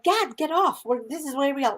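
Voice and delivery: urgent call. Retold or imitated. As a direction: hurry him off the train. Urging dad off train.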